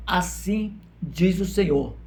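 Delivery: very slow, drawn-out pronouncement; prophetic proclamation. Retold or imitated. Preacher quoting all the prophets together, slow solemn proclamation. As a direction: stretch each word like a prophet's formula. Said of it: "todos falavam".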